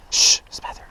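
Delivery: whispering. Real, not acted. Hushing teammate.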